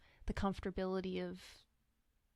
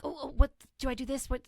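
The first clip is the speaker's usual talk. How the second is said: higher, louder, hurried flustered questions; imitating a nervous inner voice; anxious questioner; 5 semitones higher, 6 dB louder, much faster than usual. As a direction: rattle off panicky self-doubting questions, mock flustered. Voicing an anxious questioner's thoughts.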